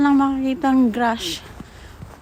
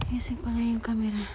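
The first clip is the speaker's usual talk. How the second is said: whispering; 7 dB quieter than usual. Real, not acted. Aside while fixing the camera.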